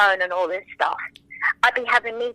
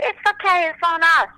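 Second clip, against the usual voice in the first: gushing shop staff; 8 semitones higher, 4 dB louder than usual. Retold or imitated. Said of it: "they'd be like".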